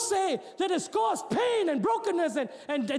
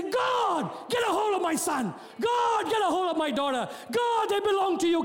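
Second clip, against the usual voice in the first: shouted prayer; 4 semitones higher than usual. Retold or imitated. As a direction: cry out to God for your kids. Acted prayer shouted for kids.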